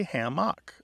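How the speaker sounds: hammock as ham-MOCK, full last vowel; second-syllable pronunciation. Shown as a demonstration. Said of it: "people who say hammock".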